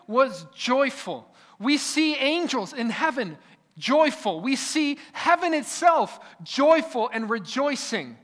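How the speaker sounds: sermon climax, preacher lifting the congregation; preaching at full force. Acted. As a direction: drive it home from the pulpit.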